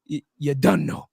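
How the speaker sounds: put-on tentative try at a West Indian accent. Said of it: "am I saying it right, Kerry?".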